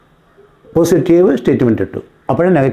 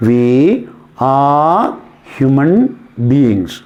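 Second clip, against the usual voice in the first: word-by-word dictation; 4 semitones lower, much slower than usual. Shown as a demonstration. Teacher gives students the example sentence, word-by-word dictation. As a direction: pause after each word, pitch stepping down.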